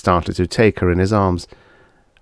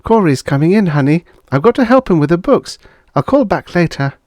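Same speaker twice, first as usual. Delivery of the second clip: higher, brisk neighbourly woman; lightly voiced friend Lucille; 11 semitones higher, 5 dB louder than usual. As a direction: play the friend a bit higher. Lucille hurried.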